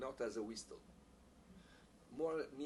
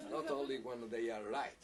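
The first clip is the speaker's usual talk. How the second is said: laughing; 3 semitones higher, 4 dB louder than usual. Real, not acted. Laughs about matching wrong players.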